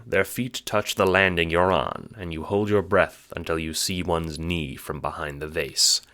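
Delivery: ordinary talk, nothing marked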